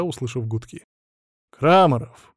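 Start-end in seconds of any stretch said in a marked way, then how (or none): 1.5-2.3 curt, louder self-introduction; stern phone answer. A narrator's reading. Boss picking up the phone, stern. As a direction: answer severely with just the surname. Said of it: "послышалось суровое".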